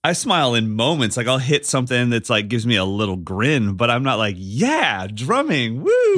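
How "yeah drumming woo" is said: sarcastic whooping enthusiasm; mock hype. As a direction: pretend to be thrilled about drumming, ending in a squeal. Mimics over-excited grinning drummer.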